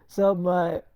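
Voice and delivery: usual speaking voice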